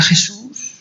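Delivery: small, high, timid call; mock a meek, polite little call; tiny little voice. Preacher mocking how the blind man didn't call, tiny voice. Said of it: "dijo así una vocecita".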